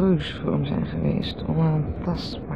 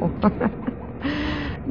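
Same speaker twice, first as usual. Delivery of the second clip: laugh; 8 semitones higher, 5 dB quieter than usual. Acted. Mocking the other's nonsense.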